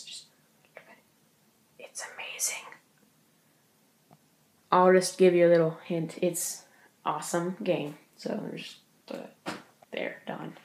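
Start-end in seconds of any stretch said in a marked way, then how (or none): 0.0-2.7 hushed awed whisper. Acted. Awed showing off the game box. 8.2-10.6 muttered whisper to himself. Real, not acted. Quietly putting the box away.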